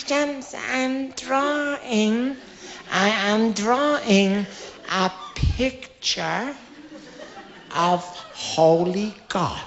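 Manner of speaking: a lightly voiced little girl, very slow; drawn-out, earnest child answering, x0.4. Little [name] slowly tells the teacher what she draws, earnest child.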